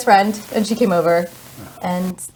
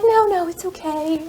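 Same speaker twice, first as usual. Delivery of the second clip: polite refusal; 11 semitones higher, slower than usual. Retold or imitated. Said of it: "I was like".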